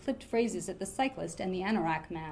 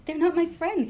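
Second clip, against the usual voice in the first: indignant protest, higher and a little louder; 7 semitones higher, 4 dB louder than usual. A narrator's reading. Narrator denying to the saleswoman, mildly protesting. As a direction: push back at the saleswoman's accusation. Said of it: "I protested".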